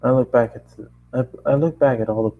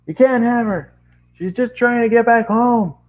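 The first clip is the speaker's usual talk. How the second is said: pleading shout; 12 semitones higher, 4 dB louder than usual. Acted. Narrator defends Twilight.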